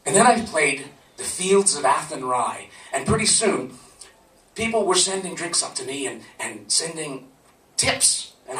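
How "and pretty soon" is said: usual speaking voice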